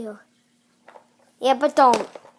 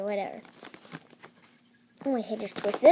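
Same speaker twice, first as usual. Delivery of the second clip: ordinary talk, nothing marked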